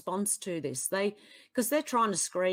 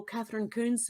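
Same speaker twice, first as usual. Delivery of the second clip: ordinary delivery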